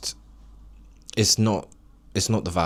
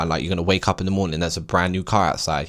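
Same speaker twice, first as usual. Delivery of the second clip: ordinary delivery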